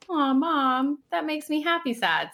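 imitating her son. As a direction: coo it like a touched five-year-old. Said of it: "he's like".

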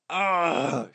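loud, high groan of fury; frustrated yell. Acted. A furious daughter alone in the hospital room, loud yell. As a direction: let it burst out in exasperation. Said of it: "she yelled".

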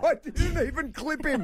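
high, slow squeaky parody of the excuse; teasing high-pitched mock; mocking imitation. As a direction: mimic her defence in a silly high voice.

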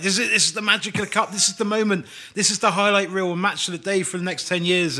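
high, rushed burst of belief; excited fan. Acted. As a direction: get carried away, pitched well up. Acting excited fan at near goal.

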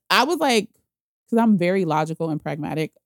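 usual speaking voice

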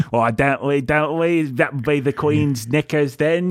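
hammed-up British banter; mock British accent. Host joining co-host's bit, mock British accent. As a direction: pile on clichés in bad British. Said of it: "the whole episode this way".

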